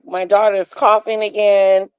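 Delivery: normal talking voice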